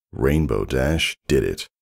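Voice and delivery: ordinary delivery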